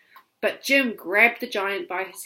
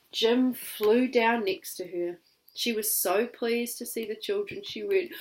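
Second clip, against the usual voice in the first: ordinary delivery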